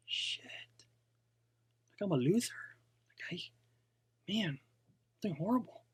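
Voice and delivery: soft, deflated muttering with gaps; acted dejection. New seller comparing numbers with big earners, acted quiet dejection. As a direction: play a downhearted seller, voice dropping away. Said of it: "makes you feel down".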